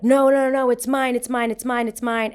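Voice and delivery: loud, high mimicked protest; acted-out panicky denial, starting loudest; frantic denial. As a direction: imitate a kid insisting over and over. Alex frantically claims phone is his.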